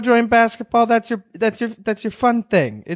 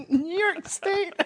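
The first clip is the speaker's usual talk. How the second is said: higher, slow, quiet mocking echo; mock incredulous echo; 13 semitones higher, 7 dB quieter, slower than usual. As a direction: savor the absurdity slowly. Echoing mocking gift card.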